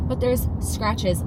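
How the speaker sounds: usual speaking voice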